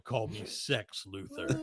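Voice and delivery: low, slow, soft seductive growl; deep seductive voice. Retold or imitated. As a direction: drop low and purr it. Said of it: "He's like".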